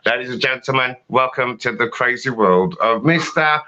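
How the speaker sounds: slow, shouted compère build-up; big showman welcome; announcer intro. Acted. Host bringing on the next act, slow shouted announcement.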